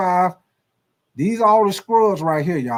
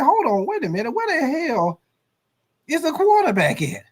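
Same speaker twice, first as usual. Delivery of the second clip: baffled coach; 8 semitones higher than usual. Acted. Coach looking for quarterback.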